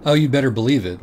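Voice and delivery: you bet I will, said with glee; eager. Acted. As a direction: relish the promise. Dirk relishing coming freakout.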